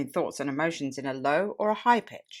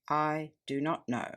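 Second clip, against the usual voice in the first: slow, softer answer on a low note; hushed, measured, lowered reply; low pitch; 5 dB quieter, slower than usual. Shown as a demonstration. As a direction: drop the voice and answer slowly. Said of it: "low pitch".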